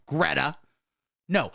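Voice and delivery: ordinary talk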